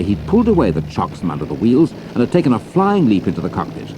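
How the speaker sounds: ordinary talk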